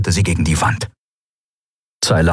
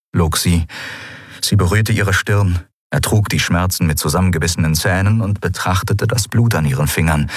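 ordinary delivery, nothing marked

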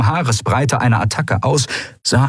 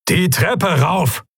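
slow, high commanding call; drawn-out high battle command; 9 semitones higher, much slower than usual. A narrator's reading. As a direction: order the men onward. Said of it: "rief der Admiral".

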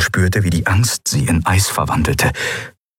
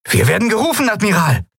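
high, urgent call to the admiral; raised-voice bridge report; 13 semitones higher, faster than usual. A narrator's reading. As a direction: sing out an incoming call with urgency. Crew member reports to admiral.

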